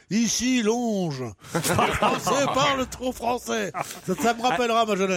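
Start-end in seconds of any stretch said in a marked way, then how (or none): 0.1-3.7 slow, hollow Radio London announcer voice; cavernous voice. Shown as a demonstration. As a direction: boom it out like a wartime broadcast. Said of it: "si vous pouvez nous faire avec une voix caverneuse".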